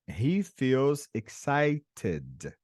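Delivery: slow, careful teacher model; slow model phrase. Shown as a demonstration. Teacher slowly models the sentence for a student, deliberate. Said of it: "a gente poderia dizer o seguinte".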